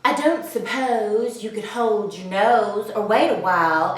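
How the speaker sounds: low, soft, sad crocodile voice. A narrator's reading. Crocodile asking the elephant, low and sad. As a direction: ask it with a sad little smile. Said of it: "asked the crocodile with a sad little smile".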